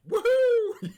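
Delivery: very high, loud whoop; hammed-up joyful cry; cheery whoop. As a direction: imitate a man whooping with glee. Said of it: "he's happy and cheery".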